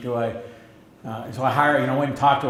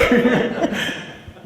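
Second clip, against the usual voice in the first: high breathy laugh; 10 semitones higher, 3 dB louder than usual. Real, not acted. Laughing at own impression.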